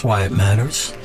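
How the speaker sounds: ordinary talk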